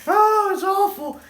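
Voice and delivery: high, loud, slow laughing groan; cracking up at how awful it is; laughing in dismay. Real, not acted. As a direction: groan it out through helpless laughter. Cracking up over the video.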